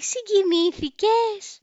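slow, high drawn-out mock tenderness; mocking the date. Narrator mimics her date asking if she's moved, high and slow. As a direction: imitate him cooing, stretched right out.

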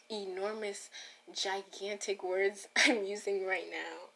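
ordinary delivery

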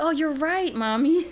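put-on high, bright little-girl voice; child's voice. Mom voicing her daughter agreeing, lightly high. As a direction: mimic a sweet young daughter.

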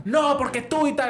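high, heated retold protest; mock quarrel. Four brawlers arguing with a waiter, mock angry protest.

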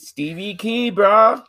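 high, loud surfer-dude drawl on brah; stoner voice. Pretend. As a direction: ham up the stoner bro. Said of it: "talking like a stoner".

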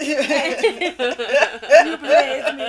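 laughing, badly faked British; mock British accent. Repeating her Idris line to laughing co-hosts, mock British accent. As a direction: break up while faking a British accent. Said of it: "British accent".

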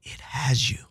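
whispered softly; hushed. Real, not acted. Storyteller hushing the moral for his listener. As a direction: drop to a whisper for effect.

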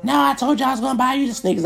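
high voice for the woman insisting; mock woman. The woman insisting she'll buy his sneakers, high-voiced. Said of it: "She like".

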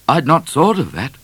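usual speaking voice

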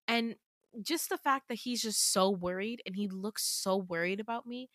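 ordinary delivery, nothing marked

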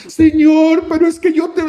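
high, loud pleading protest; protesting believer. Acted. Acted protest to God, cut off. (protesta suplicante, aguda y fuerte)

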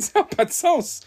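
high, laughing through the words; nervous laugh. Real, not acted. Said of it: "Je ris, c'est nerveux". (rire nerveux et aigu)

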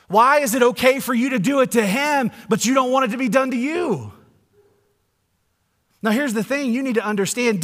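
loud, high proclamation; full-force preaching. Acted. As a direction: drive it home to the congregation.

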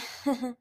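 short falling laugh; manic laugh. Acted. Icicle laughing at her sleepless scheme, manic laugh. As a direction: cackle at her own plan.

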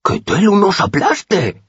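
high, slow, loud gasp of wonder; astonished exclamation. A narrator's reading. The boy on the right marvelling at the lute, high slow exclamation. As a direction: blurt out the oath in amazement. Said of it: "exclamó".

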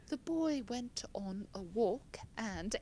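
put-on slow, careful English accent. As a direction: imitate an English accent, drawn out as for a drill. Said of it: "this whole unit in an English accent".

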